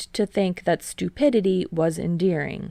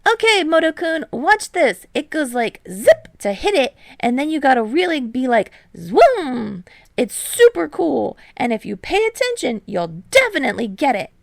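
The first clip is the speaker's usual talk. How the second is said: high, loud, fast bouncy explanation; excited Hinata; 8 semitones higher, 6 dB louder than usual. A narrator's reading. Hinata eagerly coaching Yamamoto, high and loud. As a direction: bubble over with energy.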